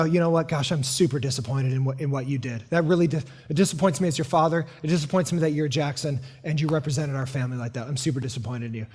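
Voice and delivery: low, soft, fading paternal rebuke; quiet disappointed dad. Retold or imitated. Dad scolds the sisters.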